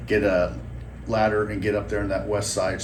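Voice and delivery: usual speaking voice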